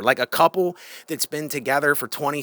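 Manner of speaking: ordinary talk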